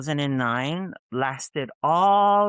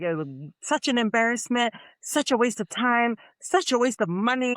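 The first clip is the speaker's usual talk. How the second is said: mock scolding mom; 8 semitones higher than usual. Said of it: "She was like".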